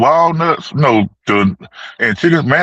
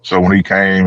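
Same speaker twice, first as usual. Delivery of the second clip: ordinary delivery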